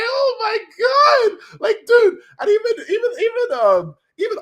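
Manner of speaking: very high, loud, near-crying cry out; teary shout. Real, not acted. Fan reacting to Ryder and Annie crying, teary shout. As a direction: wail it in disbelief.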